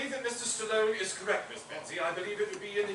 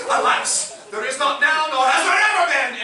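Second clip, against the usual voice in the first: indignant declaration; 6 semitones higher, 10 dB louder than usual. Acted. Butler's indignant climax.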